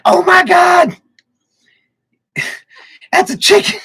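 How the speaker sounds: very high, loud squeal of a ditzy daughter; mock excited shriek. Podcaster imitating Kylie running downstairs to her surprise, hammed-up shriek. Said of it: "She's like".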